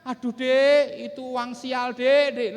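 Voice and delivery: loud, drawn-out comic lament; acted husband's dismayed wail; caught husband wailing. Caught husband wails to his wife.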